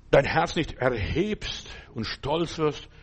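normal talking voice

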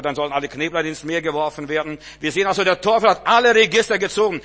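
loud, high preacher's proclamation; fervent sermon shout; full-force preaching. Acted. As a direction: drive it home to the congregation.

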